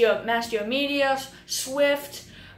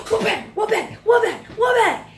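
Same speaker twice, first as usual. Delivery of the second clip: wha-bam yelled high and louder through a laugh; hammer hit sounds; 10 semitones higher, 7 dB louder than usual. Retold or imitated. Vlogger recreating Adam smashing Gingy, laughing yell. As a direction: belt out the hammer hits, laughing at the violence. Imitating Adam's brutal hammer blows.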